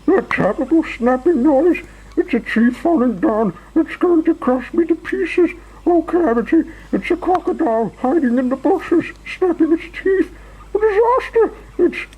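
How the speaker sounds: very high, frantic panic; Mister Jelly shrieking. A narrator's reading. Mister Jelly imagines a falling tree and crocodile, shrieking. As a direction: squeal each fear, then break off. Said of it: "he shrieked".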